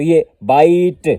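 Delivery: بائٹ said slowly, its آئی stretched; long vowel demo. Shown as a demonstration. Teacher stretching بائٹ so students hear the long vowel. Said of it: "long vowel کی sound ہوگی".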